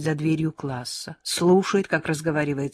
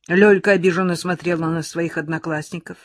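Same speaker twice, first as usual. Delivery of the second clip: usual speaking voice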